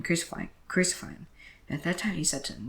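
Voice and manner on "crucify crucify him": a louder, raised crowd cry; mild read-aloud crowd shout. A narrator's reading. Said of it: "they kept shouting".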